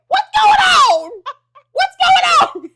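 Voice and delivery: very high, loud mock panic; hammed-up panicked screech; startled shout. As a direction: shriek it as if jolted awake. Acting out being startled awake.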